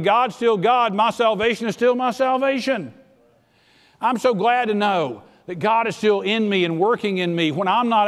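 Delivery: preaching at full force. Acted. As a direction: preach it hard and build the heat.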